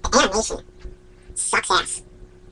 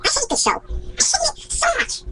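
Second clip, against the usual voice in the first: high, slow whine of hatred; exasperated whine; 12 semitones higher, slower than usual. Real, not acted. Ranting about a hated show, high pained whine.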